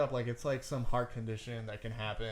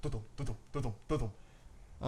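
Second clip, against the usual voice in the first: imitated heartbeat; 5 dB quieter than usual. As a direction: mimic a pounding pulse, softly. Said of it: "hear and feel my heart".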